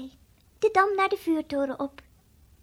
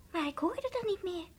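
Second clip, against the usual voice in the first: usual speaking voice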